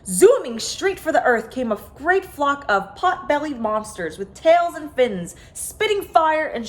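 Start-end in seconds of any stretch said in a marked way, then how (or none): none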